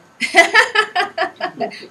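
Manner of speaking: loud, high burst of laughing; big peal of laughter. Real, not acted. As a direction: laugh out loud, real and unrestrained. Laughs at the drunk-love slip.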